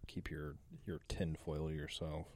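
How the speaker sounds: normal talking voice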